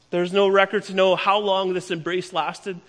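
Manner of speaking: ordinary talk, nothing marked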